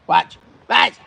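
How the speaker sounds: sudden loud call of Watch. Shown as a demonstration. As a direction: bark Watch. for attention.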